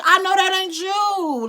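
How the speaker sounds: high, loud, slow shocked line; shocked at his handwriting; shocked disbelief. Retold or imitated. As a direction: gasp it out in disbelief. Reacting to ex's calendar.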